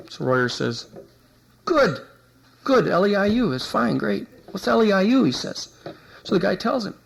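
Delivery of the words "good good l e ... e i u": much higher, eager mock approval; feigned enthusiasm. Investigator playing along with cop's answer, eager mock approval. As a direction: play along brightly, then ask innocently.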